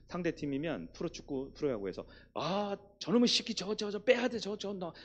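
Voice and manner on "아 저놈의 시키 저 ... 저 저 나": mock angry fan. Acts fan cursing opposing player.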